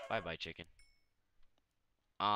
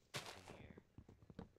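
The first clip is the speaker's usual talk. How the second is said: slow curious whisper; 3 semitones lower, 15 dB quieter, slower than usual. Real, not acted. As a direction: breathe it out, barely voiced. Curious peek into a hole.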